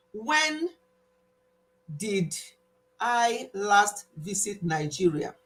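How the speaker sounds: word by word with long pauses; slow, deliberate question. Shown as a demonstration. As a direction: announce the quiz question slowly, spacing each word. Quiz question given out word by word.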